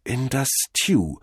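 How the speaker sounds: slow, slightly high echo; dazed repeating. A narrator's reading. Cordell echoes the god after drinking, dazed and slow. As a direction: echo it slowly, savouring. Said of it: "wiederholte Cordell und schmatzte".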